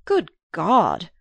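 loud, startled outburst; shocked exclamation. A narrator's reading. Archer springing up at the news, shocked exclamation. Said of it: "Archer exclaimed, springing up".

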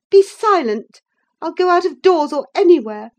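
loud, high, imperious outburst; angry interruption. A narrator's reading. Woman silences her pleading cousin, loud and angry. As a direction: cut them off sharply. She cuts off the cousin angrily.

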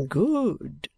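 very slow, grandly drawn-out address; self-important patriarch; pompous old father. A narrator's reading. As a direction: bestow each word like a blessing.

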